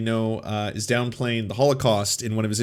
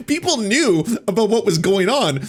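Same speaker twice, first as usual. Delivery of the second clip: laughing; 8 semitones higher, 3 dB louder than usual. Real, not acted. Laughing while correcting the claim.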